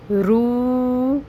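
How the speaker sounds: رو held two counts in recitation tone; رو in tilawat style. Shown as a demonstration. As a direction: sing رو for two beats. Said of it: "دو حرکت کے برابر".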